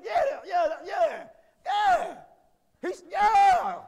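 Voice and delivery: loud, high shouted yeahs; preaching shout. Real, not acted. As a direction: shout it out to the church.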